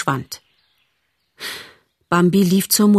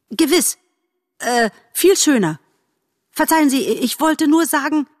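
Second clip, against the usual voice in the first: flustered; 8 semitones higher than usual. Acted. Bambi flustered apologizing.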